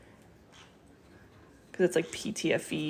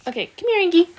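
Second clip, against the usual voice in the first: coaxing the bird, high and loud; high, loud call to the bird; 12 semitones higher, 12 dB louder, faster than usual. Real, not acted. Calling the bird over.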